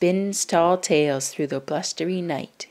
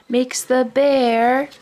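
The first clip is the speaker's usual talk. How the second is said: slow build-up; 5 semitones higher, 6 dB louder, slower than usual. A narrator's reading. Building to sneeze.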